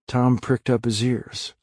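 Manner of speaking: ordinary delivery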